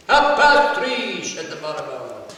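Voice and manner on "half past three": slow, high, loud call; shouted reply. Acted. Pig killer naming a time to a customer, shouted. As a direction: bellow it out, drawn out.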